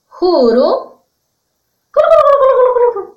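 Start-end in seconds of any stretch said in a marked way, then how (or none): 1.9-3.0 high, loud rattling gobble; turkey gobble. Shown as a demonstration. Teacher showing children the turkey's call, high gobbling. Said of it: "Ahora vamos a hacer los sonidos de estos animales".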